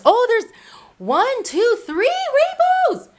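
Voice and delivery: high, rising count in wonder; excited counting. Real, not acted. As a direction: count the rainbows in delight.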